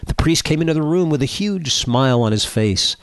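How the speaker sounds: ordinary talk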